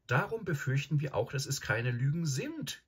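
put-on high girl's voice owning up; confessing Susan. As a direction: admit a worry in a high voice. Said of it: "gestand Susan".

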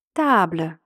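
"табле" with long stressed ТА and a barely voiced ending; model pronunciation. Shown as a demonstration. French teacher reads for learners to repeat, "табле" stressed on ТА. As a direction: say "табле" falling, stress on the first syllable. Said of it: "Я читаю, ви повторюєте".

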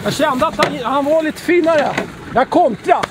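real thrill after landing a good fish; buzzing with the catch; excited angler. Real, not acted.

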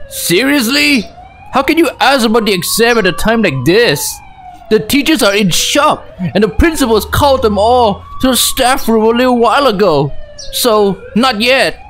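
high-pitched dude voice, mildly indignant; put-on classmate voice held up high; laid-back voice. Player as Seho scolds his friend about exams, laid-back voice. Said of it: "laid back voice".

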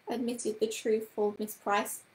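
ordinary delivery, nothing marked

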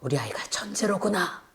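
hushed, breathy aside of wonder; whispered. Acted. Parent marveling their child is a genius, whispered. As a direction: whisper it like a secret discovery.

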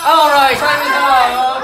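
loud, slow call to the room. Real, not acted. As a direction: announce time is up loudly. Teacher calls time.

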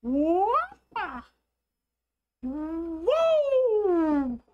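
high drawn-out wow; amazed gasp. Real, not acted. Looking at scary images, high amazed whoa. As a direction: gasp at the picture, high and long.

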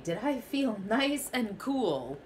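ordinary delivery, nothing marked

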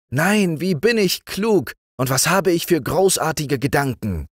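very high, self-pleased frog voice; a vain little frog king, delighted with himself; smug frog prince. Acted. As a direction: crow about your own cleverness up high.

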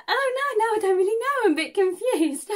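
ditzy woman caught out in a restaurant, high and flustered. Retold or imitated. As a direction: squeak out the confusion. Mandy flustered by waiter.

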